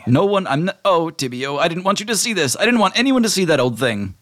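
high, flustered genie voice. Acted. Genie speaks, embarrassed.

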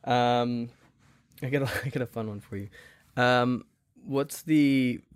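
ordinary talk, nothing marked

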